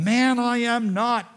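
loud, high, drawn-out protest; panicked denial. Acted. Peter answers someone who recognised him, loud panicked denial. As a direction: push back hard, stretching the words. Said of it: "And then panic strikes".

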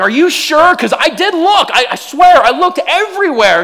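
high, loud, laughing protest; pleading protest. Retold or imitated. Husband swearing to his wife he looked, high and loud. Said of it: "I'm like".

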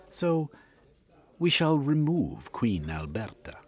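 a sly plotter laying out his scheme; scheming villain Yegman. A narrator's reading. Scheming Yegman, to Cornucope, explains his royal plot.

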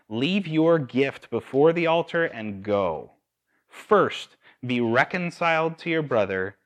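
loud, emphatic Bible reading; scripture reading. Real, not acted. Preacher reading Matthew to the congregation, emphatic scripture reading. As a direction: read it out with weight, punching the key words.

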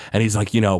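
usual speaking voice

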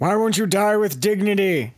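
put-on high, loud, cranky old-timer yell; old prospector. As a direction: holler at the noisy neighbour. Voicing an annoyed patient.

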